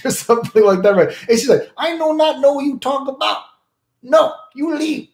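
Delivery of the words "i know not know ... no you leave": mock broken English girl. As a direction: mimic a dismissive girl, pitched way up. Girl voiced in story.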